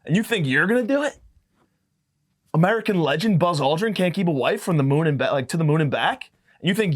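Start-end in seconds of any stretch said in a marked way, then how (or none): none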